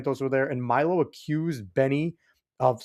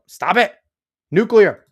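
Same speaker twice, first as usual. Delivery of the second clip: exasperated correction; 7 semitones higher, 9 dB louder, slower than usual. Real, not acted. Scolding chat over pronunciation.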